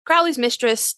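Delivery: usual speaking voice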